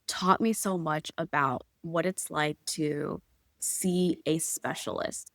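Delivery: normal talking voice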